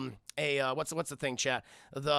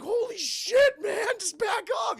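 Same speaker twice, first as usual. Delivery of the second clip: very high, shrieking panic; scared; 4 dB louder, slower than usual. Retold or imitated. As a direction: shriek it like you're terrified. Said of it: "He's scared".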